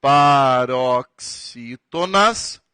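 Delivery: drawn-out Proparoxítonas, stretched syllable by syllable; stretched-out dictation of a key term; slow dictation. Shown as a demonstration. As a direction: say Proparoxítonas slowly so they can write it. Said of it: "Anote lá".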